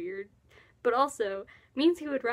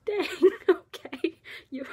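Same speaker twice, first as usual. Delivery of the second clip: slow, high and louder through a laugh; 6 semitones higher, 4 dB louder, slower than usual. Real, not acted. Vlogger cracking up at a joke, laughing. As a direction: caught off guard, laughing it out.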